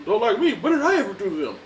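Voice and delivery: hurt, laughing indignation; Paulie offended. Retold or imitated. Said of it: "Rocky's like".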